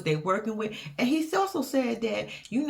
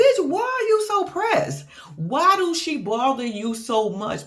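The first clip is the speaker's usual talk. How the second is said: mock yell; 6 semitones higher than usual. Vlogger quarrels back.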